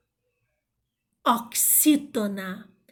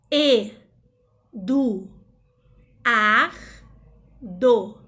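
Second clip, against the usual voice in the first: loud separate syllables with gaps; emphatic, one syllable at a time; 8 dB louder, much slower than usual. Shown as a demonstration. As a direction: chop the name into isolated beats. Splits the name into syllables.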